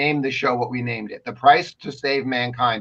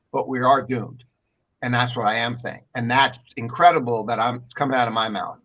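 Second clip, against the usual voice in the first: ordinary talk, nothing marked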